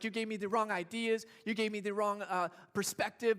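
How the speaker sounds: loud, aggrieved complaint at the parents; child's mock yell at mom and dad. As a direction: blame them loudly for getting it wrong. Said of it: "they're yelling at their parents".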